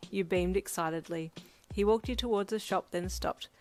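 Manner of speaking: usual speaking voice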